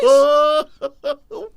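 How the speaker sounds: high, loud wailing laugh; real uncontrollable crack-up; helpless laughter. Real, not acted. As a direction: laugh until it turns into a squeal. Cracks up at the accent.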